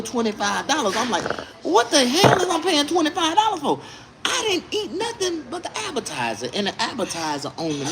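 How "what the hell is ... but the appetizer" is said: high-pitched retold outrage over a bill; indignant protest. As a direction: squawk in comic indignation.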